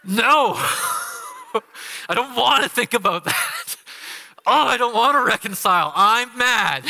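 stubborn sulk. Retold or imitated. Husband in the garden refusing to reconcile, mock sulky. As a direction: play the pouting husband who won't give in. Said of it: "and I'm just like".

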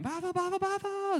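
very high, squealed toddler imitation; excited toddler scream. Dad mimics his daughter when waffle pops up, excited scream. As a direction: mimic a little girl squealing over and over, excited. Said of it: "and she screams again".

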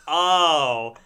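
loud, drawn-up mock dawning; imitated realisation. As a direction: act out the penny dropping. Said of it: "And she was like".

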